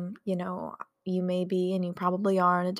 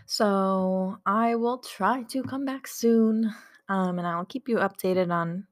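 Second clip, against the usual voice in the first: slow sign-off; 5 dB louder, slower than usual. Real, not acted. Signing off to viewers.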